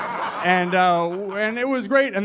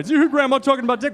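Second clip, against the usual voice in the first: put-on quick, higher, giddy kid brother; mock brother; 6 semitones higher, faster than usual. Mocking his brother, fast and high. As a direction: rattle it off like a giggly kid. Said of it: "mocking brother".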